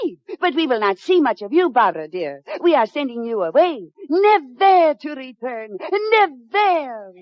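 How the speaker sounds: put-on French lady, a bit hammed-up; fake French accent. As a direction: play a fake French mademoiselle with a thick French accent. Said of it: "she has a decided French accent".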